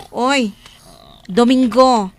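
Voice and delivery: loud sharp call to wake sleepers. Acted. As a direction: bark their names across the kitchen. Boss calls out sleeping workers. (ipukkaw dagiti nagan da a napigsa)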